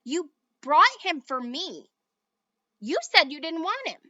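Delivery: loud heated squabble; kids' quarrel. A narrator's reading. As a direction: argue hotly over the toy. Children quarrel.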